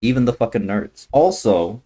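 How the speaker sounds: ordinary delivery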